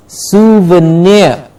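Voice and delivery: souvenir very slow, long final NEER; careful pronunciation. Shown as a demonstration. Teacher repeating it for learners, slow careful souvenir. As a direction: stretch souvenir syllable by syllable. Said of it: "Please remember the pronunciation".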